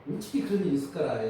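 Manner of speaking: quick Jeolla-dialect 어떻게 그런 일이 있을까라 with a falling end. Shown as a demonstration. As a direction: rattle it off the Jeolla way. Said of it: "이게 전라도".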